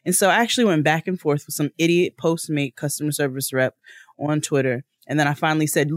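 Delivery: ordinary talk, nothing marked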